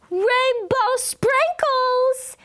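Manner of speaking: put-on high, slow, loud child's yell; stretched high happy yell; kid yelling. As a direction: yell it out with joy. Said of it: "he yelled".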